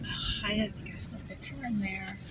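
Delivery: hushed self-talk after a wrong turn; whisper. Real, not acted. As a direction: breathe it out in a worried whisper. Wrong turn while driving.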